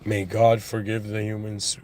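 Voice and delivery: ordinary delivery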